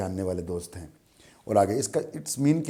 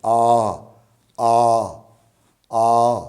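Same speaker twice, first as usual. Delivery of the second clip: long loud आ, as if calling someone far away; calling from afar; 9 dB louder, much slower than usual. Shown as a demonstration. Teacher shows आ like calling someone far off, loud. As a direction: call out आ across a field. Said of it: "जैसे किसी को बुला रहे होते हैं दूर से".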